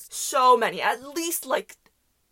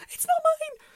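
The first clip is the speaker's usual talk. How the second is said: very high mock-defensive cry; hammed-up high denial; squealed protest; 4 dB louder, slower than usual. Protesting baby looks like hers.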